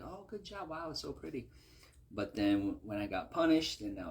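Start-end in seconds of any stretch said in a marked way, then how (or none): 0.0-1.5 higher and softer, a hint of a doting mother; praising mom. Mother praising her son's paper turkey, gently. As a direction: coo over the gift.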